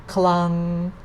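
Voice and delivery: Clung held even on one pitch; steady unbending tone on Clung; level-tone demo. Shown as a demonstration. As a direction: say Clung long and level, no glide. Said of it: "Let's say that again".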